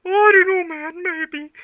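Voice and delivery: very high squeaky voice, fading off; squeaky Bob. Acted. Bob the minion squeaks a shrug. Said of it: "and Bob says".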